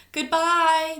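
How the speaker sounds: high, loud farewell call; called out goodbye. Acted. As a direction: shout it across the room as they rush out. Students calling out goodbye.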